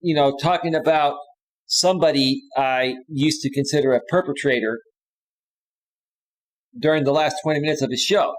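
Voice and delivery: ordinary talk, nothing marked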